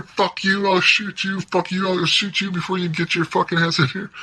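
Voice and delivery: high-pitched retold shouting, no real volume; mock screaming match. Cops and guards at gunpoint, retold as high mock screaming. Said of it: "Everyone is fucking screaming at each other".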